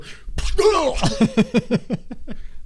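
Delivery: loud mock crash into ropes; high, loud splat noise breaking into laughter; imitated collision noise. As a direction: burst out a crash sound, then laugh. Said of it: "and then we'd go".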